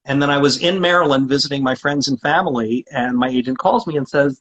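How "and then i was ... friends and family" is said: usual speaking voice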